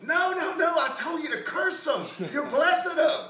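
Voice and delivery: very high, loud mock outrage; exasperated shout. King to the prophet who blessed the enemy, exasperated shout. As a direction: yell in exasperated protest, hamming it up.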